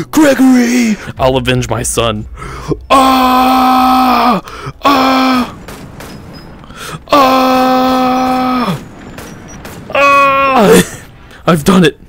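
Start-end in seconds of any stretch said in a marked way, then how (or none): 0.1-1.0 high, loud call for Gregory. Real, not acted. Calling out to lost son. 2.9-11.0 battle yells. Real, not acted. Battle cries while fighting.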